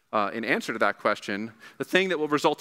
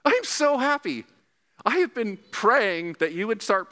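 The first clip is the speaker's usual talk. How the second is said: much higher, slow, overjoyed; excited; 10 semitones higher, slower than usual. Retold or imitated. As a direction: gush with delight. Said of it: "I said".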